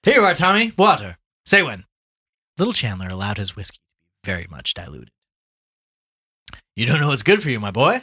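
high, loud, breezy greeting; hearty Gallagher, hailing a friend. Acted. Gallagher hails his old friend Tommy at the bar, hearty. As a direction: boom it out like a back-slapping bar regular.

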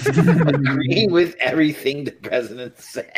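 laughing card reading. Real, not acted. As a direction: read it through real giggles.

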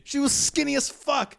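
loud, high, emphatic denial; indignant protest. Real, not acted. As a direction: burst out in outraged correction. Indignant insistence, same protest.

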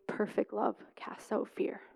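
soft unvoiced whisper of God. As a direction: whisper it gently like a voice in a dream. God speaking to her in dream.

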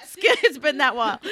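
words pushed out through real laughter; laughing while talking. Real, not acted. Correcting her own slip mid-laugh, laughing. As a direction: keep laughing through the line.

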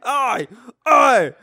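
hammed-up shouted Cockney accent. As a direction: bark it out like a Cockney thug. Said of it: "they all have Cockney British accents".

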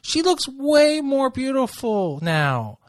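high, squealing delight; excited gushing. Real, not acted. Friend gushes at the TV over the contestant, squealing high. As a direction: gush over her like a fan.

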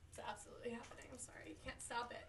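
soft whispered confession; hushed, breathy and unvoiced; whispering. Real, not acted. Whispering while starting to snack.

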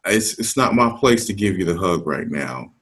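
ordinary talk

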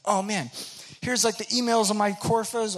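high, loud, booming friendly dude; loudest guy ever. Retold or imitated. Kevin Earnest inviting the newcomer, booming and friendly. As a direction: greet a stranger like a long-lost buddy at full volume. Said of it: "the loudest human being I've ever known".

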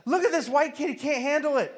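very high, teasing onlooker voice; mocking family. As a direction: ridicule the kid in a high voice.

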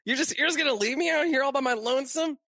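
high, quick mock complaint; Deadpool voice. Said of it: "And he's like".

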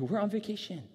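high-pitched comic protest; amused, whiny mock complaint; mock whining. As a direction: object playfully, as if work interrupts a holiday. Repeats disciples' mock complaint, laughing.

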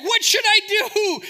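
high, loud, drawn-out dithering; hammed-up cry for guidance; imitated anxious plea. Believer asks God for direction, mock-frantic and high. Acts out a person seeking guidance.

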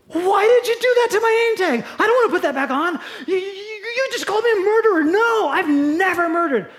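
high, loud indignant outburst; Martha outraged. Acted. Martha shouting at Jesus about her name tag, high and loud. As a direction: protest the label furiously.

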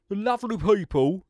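put-on Del Boy voice. Host still in his Del Boy sales voice. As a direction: lay it on like a market trader. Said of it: "I ain't gonna speak like that".

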